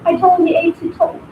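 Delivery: ordinary talk, nothing marked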